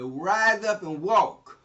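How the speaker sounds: slow, loud, each word hammered out; booming command. Acted. Peter commanding the lame man to rise, booming and slow. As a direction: proclaim it like a healing command.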